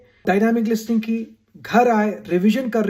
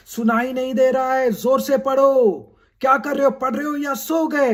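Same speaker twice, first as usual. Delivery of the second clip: raised, high-pitched scolding parent; 4 semitones higher than usual. Acted. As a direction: call out like a parent checking up on a studying child. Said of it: "घर में हमको बोला जाता है".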